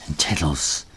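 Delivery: usual speaking voice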